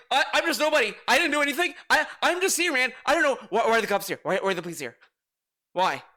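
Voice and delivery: mock panic, stammering and repeating in a rush; freaking out. Acting out a man questioned by police, mock freaking out. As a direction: imitate a flustered innocent babbling under suspicion. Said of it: "this dude is, like, freaking out".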